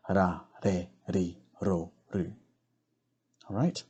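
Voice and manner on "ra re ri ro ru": "ra, re, ri, ro, ru" with each R a soft D-like tap; spaced syllable drill with soft tapped R. Shown as a demonstration. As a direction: tap the R softly like a D, one syllable at a time. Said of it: "Softer D sound".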